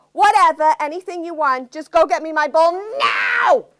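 high impatient princess yelling; bratty princess shout. Acted. Princess demands her ball.

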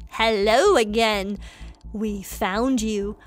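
low, slow menacing bully. Acted. Bully corners the player, low slow taunt. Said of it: "Bully one's like".

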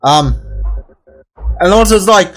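ordinary delivery

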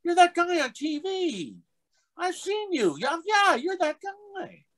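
very high-pitched, drawn-out recognition; imitated gawking passengers. Bus riders recognize man from TV, squeaky high gushing. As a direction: gush in a squeaky onlooker voice. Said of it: "everybody on the bus is looking".

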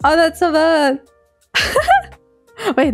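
ordinary delivery

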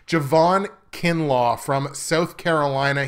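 ordinary talk

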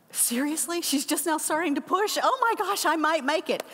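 suddenly hopeful, voice jumping up; excited disbelief. Retold or imitated. Relieved disbelief at text.

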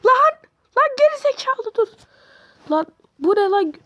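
high, loud startled yell; scared yelling; alarmed yell. Real, not acted. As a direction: shout in alarm at the monster. Jumpscare, yelling at monster.

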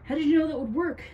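loud, high, urgent question; asking while fleeing. A narrator's reading. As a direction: call it out on the run. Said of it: "Tim asked as they ran".

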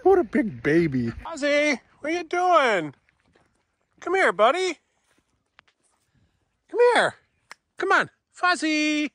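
high sing-song voice for the cat; pet talk. Real, not acted. Calling the crying cat over, high coaxing pet talk.

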